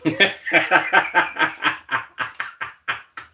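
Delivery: cracking up at the clip. Real, not acted. Reacting to the clip.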